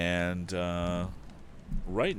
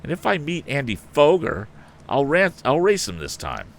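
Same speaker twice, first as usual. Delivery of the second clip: eager boast; 9 semitones higher, 4 dB louder than usual. A narrator's reading.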